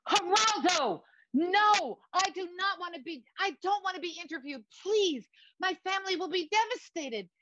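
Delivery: high, frantic begging; desperate high-pitched protest; panicked pleading. Acted. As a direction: plead in a high, distressed wail. Begging not to be interviewed.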